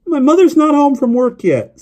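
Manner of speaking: put-on high, loud boyish protest; high-pitched little boy on the phone. A narrator's reading. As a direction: pipe up loudly in a young boy's voice. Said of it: "his voice higher pitched".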